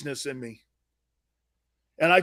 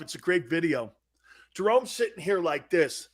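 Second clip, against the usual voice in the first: ordinary delivery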